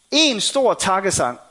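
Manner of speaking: ordinary talk, nothing marked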